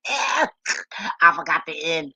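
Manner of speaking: slow, giggly, changed voice; amused slow admission. Real, not acted. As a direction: admit it while still amused. Amused at her typo.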